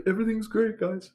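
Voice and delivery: high-pitched cover-up for coworkers; fake cheerfulness. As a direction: pipe up as if nothing is wrong. Faking okay to hide crying.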